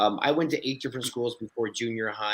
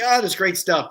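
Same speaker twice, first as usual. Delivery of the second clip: pleased director; 6 semitones higher, 7 dB louder than usual. Retold or imitated. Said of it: "He's like".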